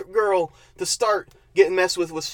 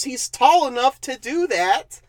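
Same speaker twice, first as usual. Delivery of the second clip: ordinary talk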